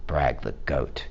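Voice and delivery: usual speaking voice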